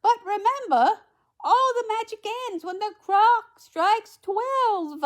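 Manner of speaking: very high, loud, drawn-out warning; high, emphatic storybook godmother; fairy godmother warning. A narrator's reading. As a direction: warn her grandly, slowing toward the end. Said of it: "said the fairy godmother".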